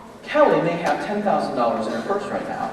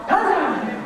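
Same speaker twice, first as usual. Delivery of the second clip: yelled positive; 10 semitones higher, slower than usual. Shown as a demonstration. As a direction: call out Positive! high and bright. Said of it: "I've never had anyone yell out positive".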